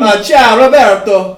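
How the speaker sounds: high, loud hammy Italian greeting; Italian accent. Pretend. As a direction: belt out a mock Italian hello.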